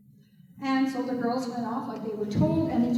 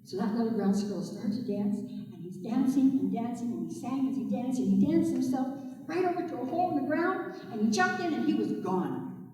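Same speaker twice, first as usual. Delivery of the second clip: plain reading voice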